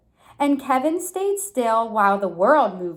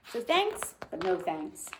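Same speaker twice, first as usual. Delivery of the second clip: plain reading voice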